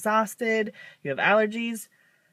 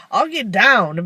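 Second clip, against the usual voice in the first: said louder, a bit bold; 3 semitones higher, 8 dB louder, slower than usual. Retold or imitated. To her mom, offering loudly to get down. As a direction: announce it loudly. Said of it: "And I'm like".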